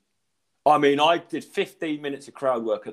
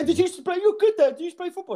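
much higher mock-impressed voice; imitated impressed players; faster than usual. Players praising comic's football, high and impressed. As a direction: ask as amazed onlookers, pitched right up.

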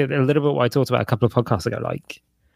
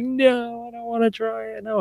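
high small refusal; father imitating his son refusing a snack; whiny child; 13 semitones higher than usual. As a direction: mimic the son saying no. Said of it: "And he was like".

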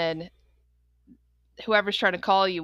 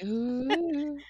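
soft, higher mock-mellow coo; mellow ooh; 6 semitones higher, 4 dB quieter than usual. Host teasing guest about her mood lighting, mock mellow. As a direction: lightly imitate someone blissfully relaxed. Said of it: "she's too mellow in her studio".

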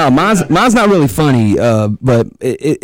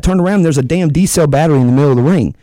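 usual speaking voice

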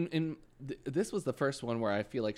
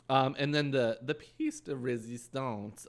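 ordinary delivery

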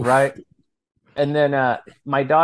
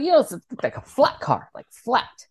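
imitating his son; 10 semitones higher than usual. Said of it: "He's like".

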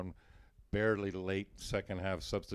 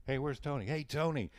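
mild mock yelling; 6 semitones higher than usual. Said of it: "on the team are yelling".

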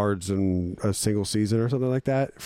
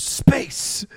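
high breathy whisper of Space; 13 semitones higher than usual. Retold or imitated. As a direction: hiss out Space like a sci-fi trailer. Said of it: "he just goes".

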